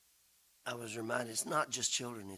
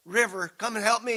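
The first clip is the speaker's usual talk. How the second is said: louder, higher call to River; calling for help; 7 semitones higher, 9 dB louder than usual. Retold or imitated. Dad on the porch calling for River's help, loud. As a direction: shout for River to come help.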